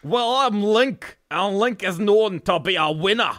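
hammed-up hero's boast. Link boasting to his opponent, hammed-up brag. Recorded take of mock fight.

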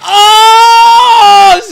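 loud, high whoop of victory; triumphant shout. Real, not acted. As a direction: yell out the win. Celebrates hit.

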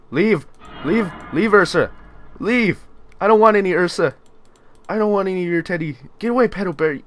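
high, loud, scared commands; panicked shooing. Real, not acted. Player shooing a teddy bear in a horror game, panicked shouting. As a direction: shout it away in a fright.

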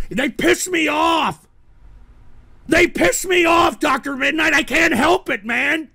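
loud, exasperated retort; angry shout. Real, not acted. Streamer answering his moderator, angry shout. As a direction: snap back angrily.

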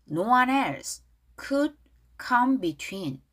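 very slow, evenly spaced phrases; read-along demonstration. Shown as a demonstration. Teacher leading pronunciation practice, slow read-along. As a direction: draw each word out so learners can copy. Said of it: "큰 소리로 발음 따라 해보겠습니다".

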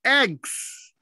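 Eggs said slowly with both the g and a final s sounding; teacher modelling Eggs for the class; clear model word. Shown as a demonstration. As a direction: show every sound of Eggs, lips visible. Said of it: "Listen and watch".

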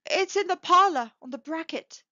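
high, loud start settling to a puzzled end; marveling. A narrator's reading. Husband puzzled by his wife's question, marveling. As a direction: answer in wonder. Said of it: "he replied, marveling".

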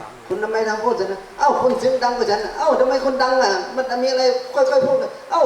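high-pitched bickering between husband and wife; hammed-up high spousal spat; mock shouting quarrel. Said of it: "ตะโกนกัน อ่ะ ดังไปดังมา".

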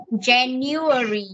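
January said slowly and clearly; slow model word. Shown as a demonstration. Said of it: "Cô đọc cho con và con đọc theo".

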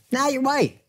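high-pitched mock brush-off; mock dismissal. Voicing a dismissive attitude to friends.

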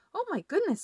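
high, startled exclamation; surprised doctor. Acted. Doctor hearing of the injured monster, high surprised cry. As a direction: gasp it out in sudden alarm.

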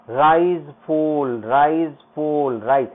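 "Rise" swung up, "फॉल" dropped, slowly; drawn-out wave on "rise फॉल"; rise and fall demo. Shown as a demonstration. Teacher shows children how music moves, slowly rising and falling. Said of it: "music play करने में ऐसे चलता".

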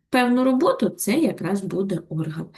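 usual speaking voice

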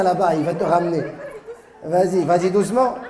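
ordinary talk

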